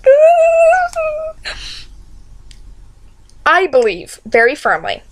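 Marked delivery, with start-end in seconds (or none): 0.0-1.5 high, hammed-up sobbing stammer; mock-crying, very high and broken; Merry. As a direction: act out falling apart in wails, unable to finish. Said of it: "and Merry's like".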